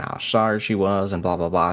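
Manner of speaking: ordinary talk, nothing marked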